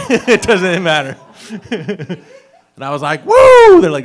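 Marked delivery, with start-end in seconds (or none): 3.2-3.8 very high, loud "woo"; celebratory whoop. Retold or imitated. Reenacting his cheer at a celebration of life, whooping. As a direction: let out a piercing party whoop.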